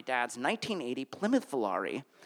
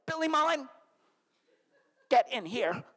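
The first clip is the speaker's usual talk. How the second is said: stern mom calling; 8 semitones higher than usual. Retold or imitated. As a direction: call him in like an angry mom. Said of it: "my mom's like".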